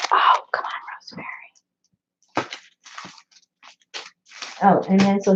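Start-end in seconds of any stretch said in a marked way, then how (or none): 0.1-2.5 whispery nudge to oneself; whispered self-chiding. Real, not acted. Crafter scolds herself for forgetting, whispered self-chiding. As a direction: whisper it as a private prod. Chides herself while searching memory.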